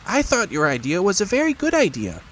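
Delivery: small high squeaky piglet voice. A narrator's reading. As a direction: play a tiny timid piglet, high up.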